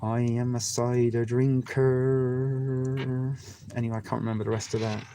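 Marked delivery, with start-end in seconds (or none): 0.0-3.0 put-on very slow Wiltshire accent, "drinker" stretched long; drawn-out Wiltshire accent. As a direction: drag out "I am a cider drinker" in a thick Wiltshire accent. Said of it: "that good old Uara Wiltshire accent".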